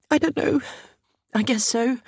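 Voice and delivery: high, helpless voice; distraught mother. A narrator's reading. Mother answering the sergeant's question, distraught.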